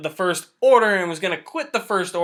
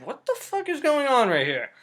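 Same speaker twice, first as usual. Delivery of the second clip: high-pitched acted disbelief; mock bewilderment; 7 semitones higher than usual. Viewer reacting to her visions, mock bewilderment. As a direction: play baffled shock, pitched up. Voicing shock at Rey's visions.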